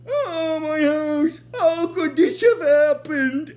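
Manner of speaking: high, hammed-up wail of dismay; mock-anguished cry, drawn out at the end; Zoidberg. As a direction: imitate a distraught character lamenting a loss. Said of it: "'Cause he's like".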